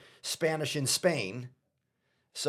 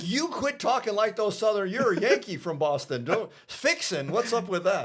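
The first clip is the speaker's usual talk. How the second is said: higher, mock-stern mother scolding; scolding mom; 7 semitones higher, 4 dB louder than usual. Mom scolds her son over the phone, mock stern. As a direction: play his mom chewing him out, pitched up. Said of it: "my mom chewed me out".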